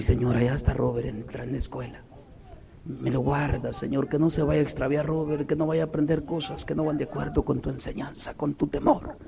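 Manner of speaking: murmured private prayer; hushed prayer. Retold or imitated. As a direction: pray quietly for your child. Acts out his prayer for son.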